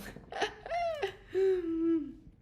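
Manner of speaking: high tearful burst of laughter; laughing until nearly crying; real laugh. Real, not acted. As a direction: laugh helplessly at the joke. Laughing about Jeff.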